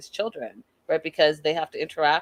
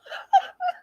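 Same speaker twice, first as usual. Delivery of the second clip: real laugh breaking out, much higher; high burst of laughter. Real, not acted. Vlogger laughing at her own retelling, a high burst of laughter. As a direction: let a laugh escape high and sudden.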